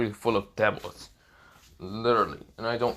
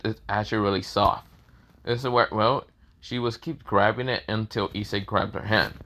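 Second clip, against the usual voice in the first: plain reading voice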